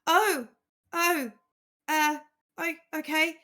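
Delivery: high, loud, flustered gasp; panicked sudden deference; flustered shock. Acted. Acolyte shocked at news.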